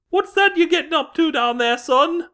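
high mother's voice calling down the stairs; nosy parent calling from upstairs, pitched high. Acted.

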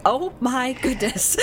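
imitating kids sounding like old folks; old people voice. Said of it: "they sounded like old people going".

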